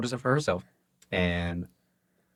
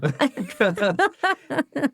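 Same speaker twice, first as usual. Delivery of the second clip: loud high laugh; laughing hard at the punchline; high burst of laughter; 5 dB louder than usual. Real, not acted. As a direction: let a big laugh out. Big laugh after punchline.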